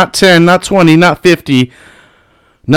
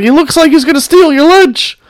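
mocking high voice; 12 semitones higher than usual.

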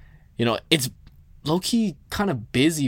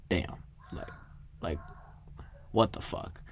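stunned breathy whisper of disbelief; shocked whisper; 6 semitones lower, 13 dB quieter than usual. Retold or imitated. To friends after the cashier's warning, a shocked whisper. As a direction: breathe it out, shaken and hushed.

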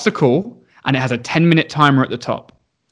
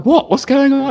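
confused husband; 11 semitones higher than usual. Retold or imitated. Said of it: "his husband's like".

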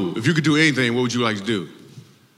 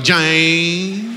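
Jane stretched long, rising, with a Southern twang; long twangy Jane; 5 semitones higher than usual. Retold or imitated. Comic mocking Jane's long twangy name. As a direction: drag Jane out with heavy twang. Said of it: "a lot of twang on the end of it".

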